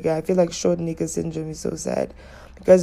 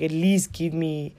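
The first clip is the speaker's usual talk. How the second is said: ordinary delivery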